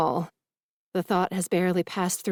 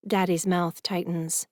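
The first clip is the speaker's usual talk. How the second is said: usual speaking voice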